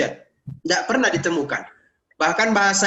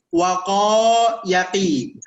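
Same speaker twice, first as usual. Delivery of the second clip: slow demo; much slower than usual. Shown as a demonstration. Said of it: "Atau misalnya begini".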